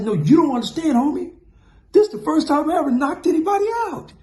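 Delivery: very high, loud disbelieving boast; overexcited boast. Retold or imitated. Narrator to Big C, breathless high excitement. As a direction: gush about the first knockout. Pleading his amazement to Big C.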